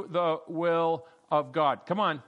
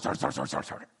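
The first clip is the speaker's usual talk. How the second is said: mock snorting. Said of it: "It was a big, scary snort".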